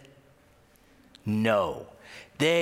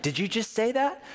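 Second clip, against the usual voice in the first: startled Jesus; 8 semitones higher than usual. Retold or imitated. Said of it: "he's like".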